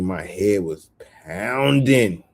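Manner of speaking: ordinary talk, nothing marked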